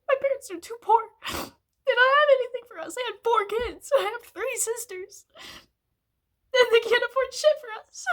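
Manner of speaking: sobbing out the frustration, voice cracking; crying. Real, not acted. Crying about poor parents, money.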